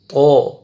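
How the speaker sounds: تو as a thick emphatic t with rounded vowel; heavy to. Shown as a demonstration. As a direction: hold تو full and heavy. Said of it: "موٹا کر کے پڑھیں گے". (موٹا کر کے تو)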